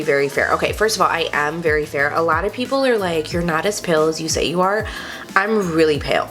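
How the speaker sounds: normal talking voice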